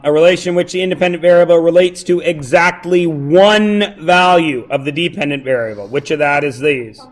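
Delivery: ordinary talk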